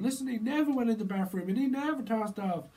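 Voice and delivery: acted indignant mother; indignant mum. Mum defending son, film retold.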